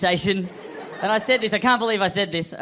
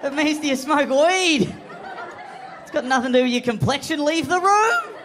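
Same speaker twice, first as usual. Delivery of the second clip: inner voice yelling; 11 semitones higher than usual. Retold or imitated. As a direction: scold yourself in your head, full volume. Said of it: "my brain's gone".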